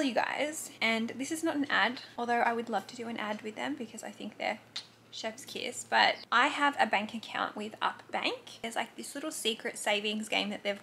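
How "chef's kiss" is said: breathy aside under her breath; tiny whispered compliment. Real, not acted. Softly added aside.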